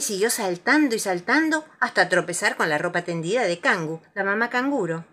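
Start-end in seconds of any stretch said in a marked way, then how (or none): none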